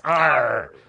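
pirate arr. Pretend. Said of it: "I am gonna do my pirate voice".

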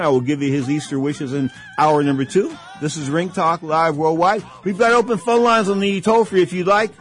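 hyped talk radio promo; radio announcer read. Acted. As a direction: sell the show like a live broadcast. Radio host reads station ID.

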